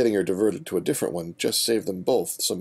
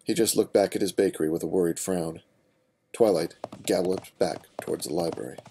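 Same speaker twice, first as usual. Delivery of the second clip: plain reading voice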